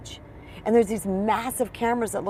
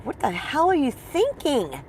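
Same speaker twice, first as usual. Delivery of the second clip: panicked self-talk; 6 semitones higher, slower than usual. Retold or imitated. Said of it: "I freaked out".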